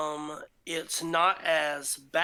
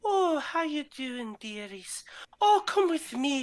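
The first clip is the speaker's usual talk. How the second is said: put-on old dwarf woman; 9 semitones higher than usual. Female dwarf fussing over the tired travelers, high and doting. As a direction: coo at them like a doting grandma. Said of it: "she goes".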